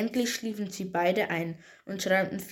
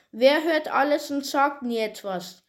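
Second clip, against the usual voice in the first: higher, louder, a touch enraged; mildly furious witch; 6 semitones higher, 5 dB louder than usual. A narrator's reading. Witch puts last riddle to Peter, mildly furious. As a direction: push the riddle out with some anger. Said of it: "Wutentbrannt stellte sie".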